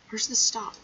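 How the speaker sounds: normal talking voice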